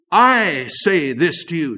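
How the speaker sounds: "I" loud, long and slow; stress on I. Retold or imitated. Preacher quoting Jesus as absolute truth, loud drawn-out "I". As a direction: hit "I" hard and hold it, then descend. Quotes Jesus with emphatic authority.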